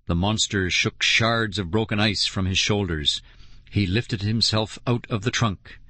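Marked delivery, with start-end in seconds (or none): none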